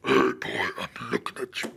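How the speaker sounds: deep, regal cosmic god; regal Galactus voice. Retold or imitated. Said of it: "Galactus would have more of a regal voice".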